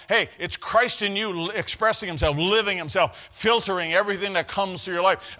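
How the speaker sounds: normal talking voice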